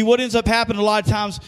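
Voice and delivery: ordinary talk, nothing marked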